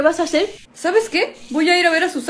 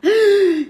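a theatrical offended gasp; hammed-up sharp intake of breath; mock gasp; 5 semitones higher than usual. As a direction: gasp as if shocked by the accusation. Accused of arranging the robbery.

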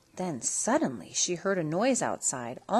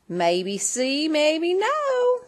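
put-on loud, higher twangy old lady; cowgirl fairy godmother; 8 semitones higher, 4 dB louder, much slower than usual. A narrator's reading. Fairy godmother greeting Cindy, loud and sassy. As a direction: play a sassy frontier granny. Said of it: "said her fairy godmother".

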